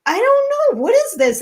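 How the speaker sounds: high-pitched, baffled protest; flustered denial. Retold or imitated. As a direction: retell it as a squeal of innocence. Flustered denial to family, retold.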